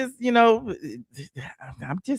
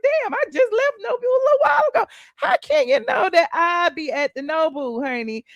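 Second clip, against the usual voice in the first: exasperated disbelief; 9 semitones higher than usual. Retold or imitated. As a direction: throw it out fast and high, baffled. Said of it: "I'm like".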